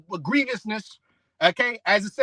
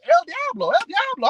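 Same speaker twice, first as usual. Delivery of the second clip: high-pitched mock exclamation; kids parroting El Diablo; 7 semitones higher than usual. Preacher mocks kids copying their parents, high mimicking voice. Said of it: "Anything happens".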